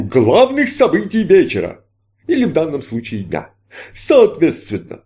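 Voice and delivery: grand stage magician, inviting; showman magician. Acted. Magician patter.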